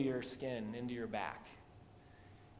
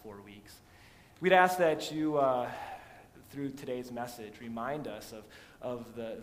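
earnest prayer voice to God; pastoral prayer; 7 dB louder than usual. Acted. Pastor praying for the congregation, reverent prayer. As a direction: lead the church in prayer.

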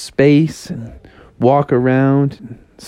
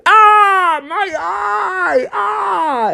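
Froggy in pain; much slower than usual. Acted. Froggy poked in the eye.

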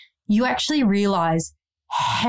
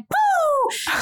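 mock cheer. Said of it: "you're like".

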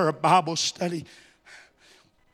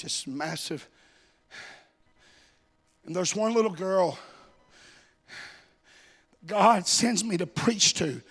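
hushed testimony; 6 dB quieter than usual. Real, not acted. Preacher recalling one girl in a congregation, hushed testimony. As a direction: drop right down and let the story breathe.

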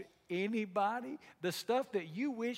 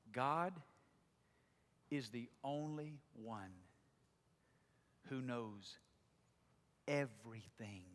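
soft, slow, low devotional reading; quiet intimate reflection; 7 semitones lower, 7 dB quieter, slower than usual. Acted. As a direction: share a private prayer slowly and softly.

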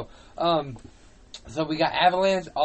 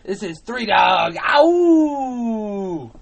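loud radio DJ shout with a howl; Three Dog; 7 semitones higher, 10 dB louder, much slower than usual. Acted. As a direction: shout the intro, then let out a long high howl. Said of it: "That's a great D J name".

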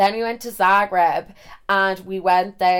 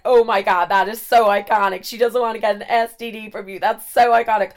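put-on louder, gushing American accent; hammed-up American delight; American guy; 4 dB louder than usual. American guy commenting on the rejection, delighted put-on American accent. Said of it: "then the American guy is like".

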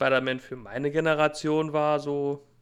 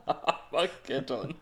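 movie trailer voice; 5 dB quieter, much slower than usual. Pretend. Echoing a dramatic film title.

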